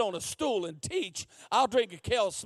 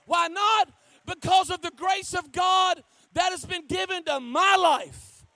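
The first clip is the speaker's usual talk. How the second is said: loud, high, driving sermon shouts; full-force preaching; 8 semitones higher, 7 dB louder, slower than usual. Real, not acted. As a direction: drive each question home at the top of your voice.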